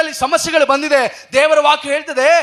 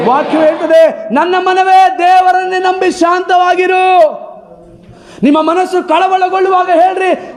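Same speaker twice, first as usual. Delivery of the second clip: raised, high-pitched preacher's cry; fiery preaching; 4 semitones higher than usual. Acted. Preacher rallying the congregation against fear, fiery preaching. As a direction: preach it out full force to the hall.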